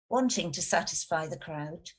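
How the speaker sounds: ordinary delivery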